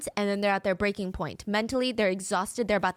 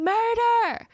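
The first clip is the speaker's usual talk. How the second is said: high, loud, drawn-out retold scream; 13 semitones higher, 6 dB louder than usual. Ship's cook screaming to the crew. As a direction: scream the word in alarm. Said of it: "He's screaming".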